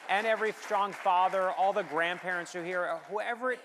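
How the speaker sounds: rousing call to applaud; calling out over applause. Real, not acted. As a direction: project over a clapping crowd.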